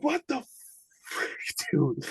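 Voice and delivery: high, startled outburst fading to a breathy "dude"; shocked disbelief at what the son says; freaked out. Retold or imitated. Father reacts to the son's ghost-girl story, startled. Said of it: "I'm like".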